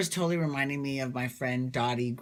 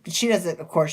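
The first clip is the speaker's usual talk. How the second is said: usual speaking voice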